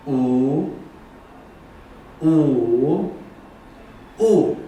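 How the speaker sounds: walk 乌 through the tones. Shown as a demonstration. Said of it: "第一个乌".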